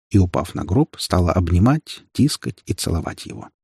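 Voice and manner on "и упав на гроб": ordinary delivery, nothing marked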